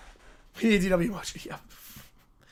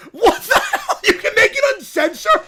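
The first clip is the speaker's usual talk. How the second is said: laughing disbelief; 8 semitones higher, 4 dB louder than usual. Real, not acted. Shocked laughing at AI output.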